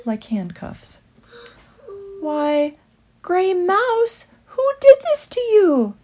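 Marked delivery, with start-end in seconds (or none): 2.2-5.9 high, hushed alarm; shocked concern. A narrator's reading. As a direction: ask gently, aghast. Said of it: "asked Woodley".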